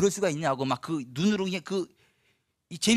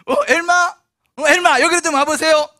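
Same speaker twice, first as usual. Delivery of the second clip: calling out; 10 semitones higher, 10 dB louder than usual. Retold or imitated. Preacher calling Elma over from across the crowd, loud. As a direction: call Elma's name out loud and high.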